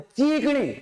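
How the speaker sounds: चीकनी with a drawn-out first "ee"; affectionate long-vowel चीकनी. Retold or imitated. Teacher mimics children's pet word, चीकनी with long ची. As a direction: stretch the ची in चीकनी, as kids fondly do. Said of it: "बच्चे इसको प्यार से बोलते हैं".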